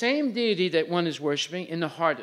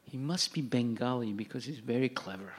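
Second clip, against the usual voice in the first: soft, low imitation of gossip; hushed retold aside; 5 semitones lower, 6 dB quieter than usual. Lady guessing his origin during the speech, hushed retold aside. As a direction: quietly confide a guess, as retold.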